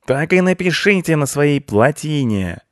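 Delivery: high, loud parting taunt; shouting after them. A narrator's reading. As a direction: yell it at their backs. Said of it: "крикнул им вслед". (крик вслед)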